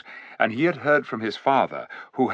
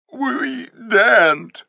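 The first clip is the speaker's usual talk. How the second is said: higher, slow frightened whimper; 12 semitones higher, 3 dB louder, much slower than usual. A narrator's reading. Cowed animals answering the king, whimpering. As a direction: shrink from the king's call. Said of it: "whimpered dozens of voices".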